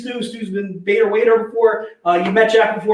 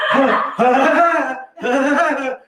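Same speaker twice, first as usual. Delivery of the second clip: slow, high mock woodpecker cackle; mocking a coworker with a woodpecker laugh; Woody Woodpecker laugh imitation; 4 semitones higher, much slower than usual.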